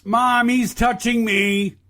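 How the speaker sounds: put-on slow, whiny kid tattling to mom; whiny tattling little sibling. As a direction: whine it like a tattling little brother. Mocking a childish tattletale.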